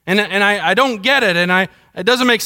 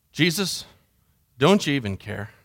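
low and soft, almost to oneself; subdued, hushed question; hurt, quiet plea; 6 semitones lower, 6 dB quieter than usual. Acted. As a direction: ask it privately, drained of force. Personal hurt plea to Jesus.

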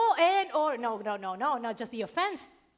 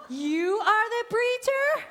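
incredulous surprise; 7 semitones higher, 4 dB louder, slower than usual. Retold or imitated. Driver surprised a tiny woman preaches.

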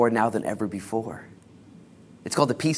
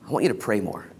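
ordinary delivery

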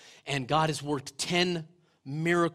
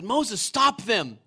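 urgent plea; 6 semitones higher, 7 dB louder, much slower than usual. A narrator's reading. Joshua urges Moses.

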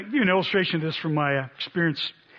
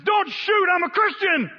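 mock panic, much higher and louder; panicked plea; 13 semitones higher, 4 dB louder, slower than usual. Drunk Evan begging the armed neighbor, high panicked plea. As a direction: squeal it like a terrified drunk. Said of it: "falls to his knees and says".

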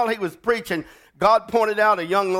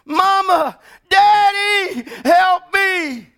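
put-on high, loud trapped child's scream; terrified girl screaming for help; 7 dB louder, much slower than usual. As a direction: scream for your parents from the wreck. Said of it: "screaming to the top of her lungs".

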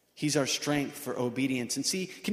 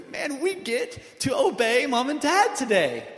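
high, slow, laughing delight; glad attitude; 8 semitones higher, 4 dB louder than usual. Retold or imitated. Kids delighted to obey parents, laughing and high. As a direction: savor the joy of getting to obey. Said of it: "that kind of an attitude where you're like".

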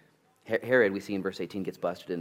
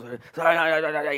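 rapid-fire sorry; 8 semitones higher, 7 dB louder than usual. Retold or imitated. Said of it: "so he goes".

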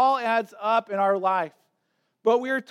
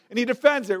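ordinary talk, nothing marked

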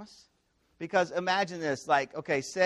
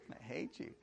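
soft mock resentment; understated, half-hearted hurt; disbelieving grumble; 3 semitones lower, 16 dB quieter than usual. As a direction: say it under your voice to teasing friends. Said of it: "he thinks that they're, like, totally kidding".

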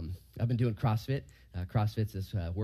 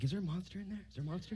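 put-on higher, softer child's voice asking; imitating his kids; 8 semitones higher, 9 dB quieter, faster than usual. His kids asking about the monster in the green box: higher, softer mock child voice. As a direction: play a curious little kid, lightly voiced. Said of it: "my kids are always like".

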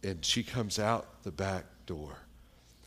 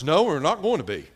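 higher, loud imitated old woman; emphatic refusal; 6 semitones higher, 10 dB louder than usual. Old woman answering visitor about church, loud blunt refusal. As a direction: shut the question down loudly.

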